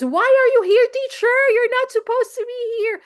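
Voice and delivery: put-on high, loud little-girl voice; child's voice. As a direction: squeal it like a bossy little girl. Girl scolding teacher at mall.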